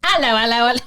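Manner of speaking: a deliberately bad Australian accent, said as a joke; mock Australian accent.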